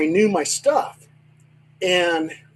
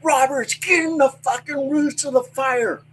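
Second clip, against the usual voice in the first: captain yelling; 8 semitones higher than usual. Retold or imitated. Fire captain yelling at Roberts to fight the fire, high yelled order. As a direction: bark it like an angry fire captain. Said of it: "the captain's yelling at me".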